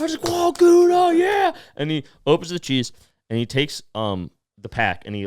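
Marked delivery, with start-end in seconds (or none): 0.3-1.5 very high, loud mock cheer; imitated crowd cheer, pitched way up. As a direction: whoop like a thrilled audience. Imitates audience cheering the cheese.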